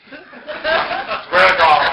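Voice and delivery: high, loud, drawn-out laughing words; laughing while talking. Real, not acted. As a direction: squeal it through helpless laughter. Breaks up while telling it.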